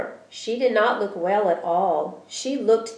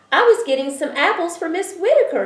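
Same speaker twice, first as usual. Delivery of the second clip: put-on excited child; 10 semitones higher, 6 dB louder, faster than usual. A narrator's reading. Child explaining from tree.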